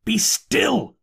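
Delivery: high, urgent plea; imploring. A narrator's reading. Narrator begging the raving curate to hush, high imploring. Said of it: "I implored".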